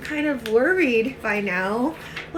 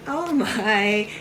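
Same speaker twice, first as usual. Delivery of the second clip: usual speaking voice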